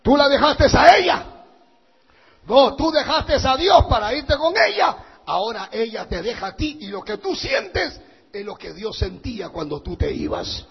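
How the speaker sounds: loud, hard rebuke of the straying man; stern rebuke. Retold or imitated. Pastor scolding the man who left church, loud stern rebuke. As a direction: scold him for leaving God.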